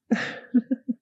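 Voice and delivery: a bright mocking giggle; high teasing giggle. Vampire mistress teasing her blushing pet, giggling.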